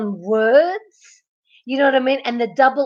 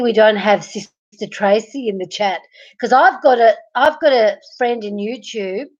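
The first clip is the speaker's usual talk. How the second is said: usual speaking voice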